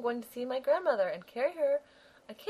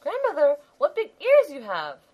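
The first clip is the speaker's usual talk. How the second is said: put-on high, loud wondering child; amazed little girl, louder; 10 dB louder than usual. Girl staring at the disguised wolf, high and loud.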